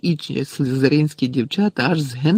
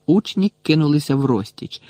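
ordinary delivery, nothing marked

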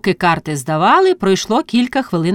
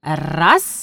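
plain reading voice

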